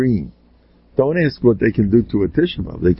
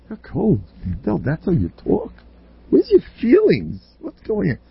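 higher, lightly voiced protester; mock complaint, pitched up; imitated objector; 9 semitones higher than usual. Acts out an objector to his coldness.

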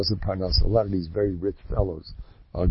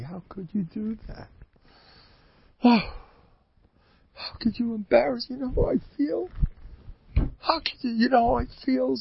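much higher, softer hurt whine; whiny wife; 12 semitones higher, 6 dB quieter than usual. Retold or imitated. Offended wife reproaching her husband, high plaintive whine. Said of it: "So the Mavazani".

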